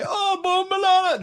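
very high, drawn-out mock Jamaican outburst; hammed-up loud imitation of Jamaican cursing; mock Jamaican freakout. Jamaican men erupt at kid, mock accented freakout. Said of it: "they started freaking out".

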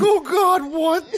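imitating dad, high with a cracking voice; a high, panicky "Oh, God" that cracks. Dad hearing mom fell, voice cracking in alarm. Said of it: "You can hear his voice crack".

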